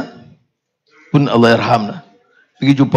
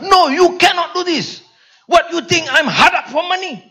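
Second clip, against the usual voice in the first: scolding in a much higher voice, imitating an angry friend; imitated angry rebuke; angry Karpal Singh; 11 semitones higher than usual. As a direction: mimic an old friend's angry telling-off, pitched well up. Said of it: "Dia jumpa saya, dia marah".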